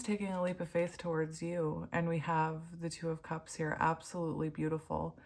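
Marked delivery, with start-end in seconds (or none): none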